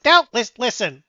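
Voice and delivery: fast, squeaky-ish scolding mouse; nervous mouse lecturing, rushed. Acted. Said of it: "the mouse speak up".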